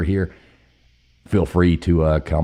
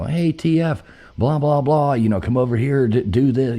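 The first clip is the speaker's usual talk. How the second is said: higher, louder barker call; carny barker call; 8 semitones higher, 4 dB louder than usual. Retold or imitated. Old classmate taunting her over the carny microphone, lightly voiced. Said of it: "he's got the microphone".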